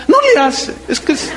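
put-on high, laughing old mother's voice; old mother laughing off her son's question, pitched high.

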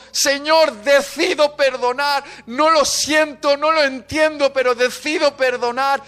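retold anguished prayer, high and loud; crying out. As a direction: cry out the decision insistently. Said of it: "llorando, diciendo".

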